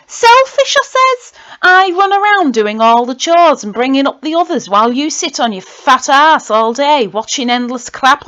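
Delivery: loud, high furious outburst at mum and dad; angry rant. Acted. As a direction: shout it out, fed up and furious.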